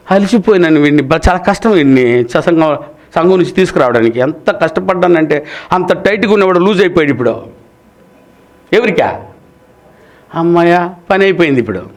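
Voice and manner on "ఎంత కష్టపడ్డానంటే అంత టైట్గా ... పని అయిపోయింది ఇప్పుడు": normal talking voice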